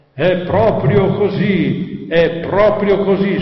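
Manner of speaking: imitated megaphone pronouncement. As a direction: boom it out like a megaphone announcement. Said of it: "la voce megafono".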